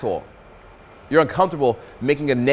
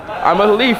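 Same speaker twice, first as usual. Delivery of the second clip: high, loud, laughing leaf voice; leaf in the wind impression; 8 semitones higher, 5 dB louder than usual. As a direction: play a silly drifting leaf. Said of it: "my leaf in the wind impression".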